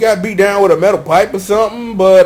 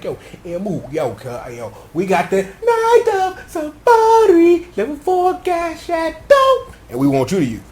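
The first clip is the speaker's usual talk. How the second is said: mock hype friend; 7 semitones higher than usual. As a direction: hype it up for your cousin. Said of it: "be like".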